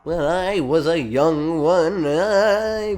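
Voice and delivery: slow nostalgic drawl. Acted. Nostalgic recollection, slowed.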